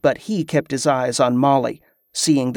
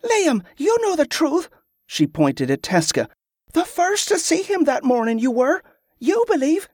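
put-on high-pitched girl pleading her case; high, insistent girlish voice; insistent young Molly; 13 semitones higher than usual. A narrator's reading. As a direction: urge them in a high child's voice.

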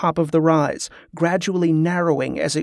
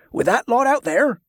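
a sharp, higher retort; snapping; 7 semitones higher than usual. Acted. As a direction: snap it back at him in disbelief. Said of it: "Clegg snapped".

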